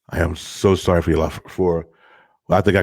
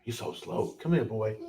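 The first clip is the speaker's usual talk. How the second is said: hushed coaxing; 3 semitones higher, 12 dB quieter than usual. Real, not acted. Coaxing son quietly.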